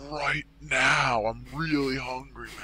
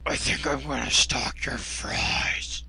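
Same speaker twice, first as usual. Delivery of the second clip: creepy whisper. Acted. Stalker voice.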